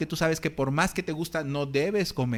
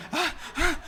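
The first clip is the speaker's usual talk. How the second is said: mock fainting gasps; 11 semitones higher than usual.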